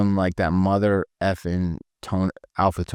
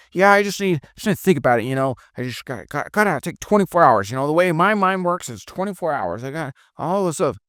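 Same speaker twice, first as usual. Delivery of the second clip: higher, lightly voiced hesitant prospect; a hint of a whiny excuse-maker; mock stalling prospect; 7 semitones higher than usual. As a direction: play the prospect putting off the decision.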